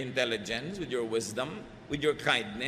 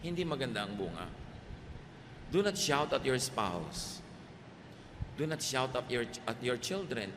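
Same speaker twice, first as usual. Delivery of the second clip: ordinary delivery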